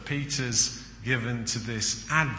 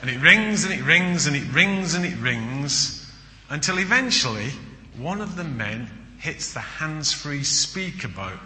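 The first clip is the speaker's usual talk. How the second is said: usual speaking voice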